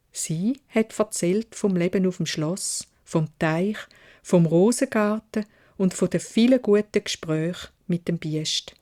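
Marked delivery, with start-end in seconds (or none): none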